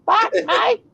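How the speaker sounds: high, loud cheering Australian voice with a laugh; excited Australian. Retold or imitated. Kiwi mimics Australians cheering, loud whooping Australian voice. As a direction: play a whooping Aussie celebrating. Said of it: "Australians are more like".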